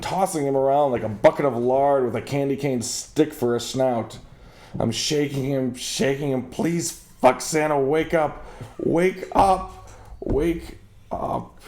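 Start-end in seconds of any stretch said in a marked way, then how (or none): 6.5-11.6 desperate plea. Acted. Pleading with Santa.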